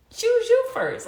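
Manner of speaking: emphatic urging. Retold or imitated. Urging friend to celebrate herself.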